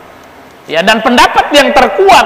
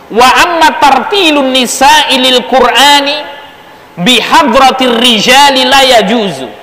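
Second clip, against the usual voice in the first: slow, raised chanting of the Arabic; measured kitab reading; Arabic text recitation; 4 semitones higher than usual. Acted. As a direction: recite the classical text slowly.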